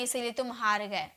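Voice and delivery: ordinary talk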